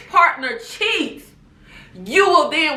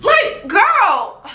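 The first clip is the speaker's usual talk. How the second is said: higher exasperated call; exasperated at Portia; 8 semitones higher, 3 dB louder than usual. Real, not acted. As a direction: throw up your hands. Exasperated outburst.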